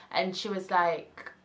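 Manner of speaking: ordinary talk, nothing marked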